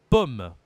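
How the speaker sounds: pomme punched loud with an open O. Shown as a demonstration. Teacher gives a contrasting example, loud open O in pomme. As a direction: hit pomme hard, O open and short. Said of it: "on a un accent différent".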